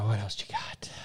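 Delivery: unvoiced whisper aside. Real, not acted. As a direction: whisper it off mic.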